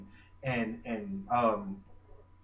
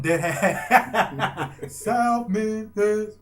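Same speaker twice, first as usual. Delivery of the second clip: higher, slow, laughing through it; 7 semitones higher, 5 dB louder, much slower than usual. Real, not acted. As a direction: laugh out the place name. Laughing at own remark.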